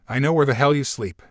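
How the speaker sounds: usual speaking voice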